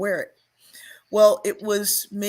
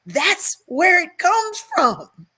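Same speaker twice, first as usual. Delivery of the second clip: excited realization; 11 semitones higher, 7 dB louder, much slower than usual. Retold or imitated. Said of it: "and I was like".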